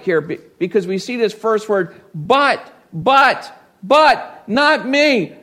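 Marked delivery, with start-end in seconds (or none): 2.2-5.4 higher, insistent repeated buts; emphatic protest. Retold or imitated. Peter insisting to Jesus, emphatic and higher. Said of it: "rather emphatic statement".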